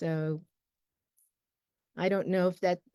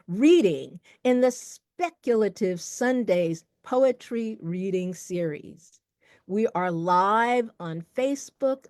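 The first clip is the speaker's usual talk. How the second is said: big, emphatic announcer delivery; event host's welcome; 4 semitones higher, 5 dB louder than usual. Real, not acted. Host welcoming viewers to the poetry reading series, grand announcer voice. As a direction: open the show with lifted, ringing emphasis.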